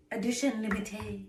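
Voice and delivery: "Edition limitée" in a French accent; French phrase. Shown as a demonstration. Putting the edition name into French for a friend, French accent. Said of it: "French, you know".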